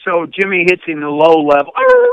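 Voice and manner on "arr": short, high-pitched loud yelp; imitated dog yelp. Hunter mimicking a dog hit by shock collar, imitated yelp.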